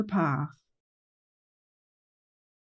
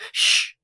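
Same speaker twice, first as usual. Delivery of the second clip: long shush; hushing shh; 4 dB louder than usual. Acted. Rainbow hushing the listening gang, long shh. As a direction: silence them with a shh.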